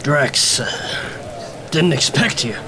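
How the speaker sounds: lightly voiced weary cop; gruff police captain. Captain talking to the detective over the bodies, a lightly voiced weary cop. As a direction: play the tired captain at the scene.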